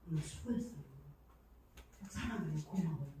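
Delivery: soft, gentle words of thanks to her husband; a quiet loving thank-you to him; tender thanks. Retold or imitated.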